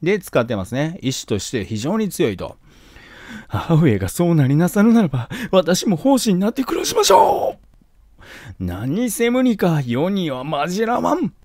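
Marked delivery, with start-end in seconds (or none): 3.5-11.3 high, tearful mock whimper; crying son. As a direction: plead through put-on tears. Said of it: "泣きながら喋ってるんですよ".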